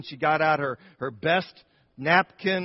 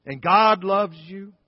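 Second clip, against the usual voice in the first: high, loud heartfelt tenderness; tender assurance; 4 semitones higher, 5 dB louder than usual. Retold or imitated. Grandmother comforting the trembling gunman, loud heartfelt tenderness. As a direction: pour out love on him earnestly. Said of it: "I said".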